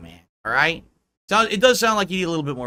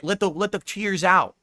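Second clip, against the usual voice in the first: usual speaking voice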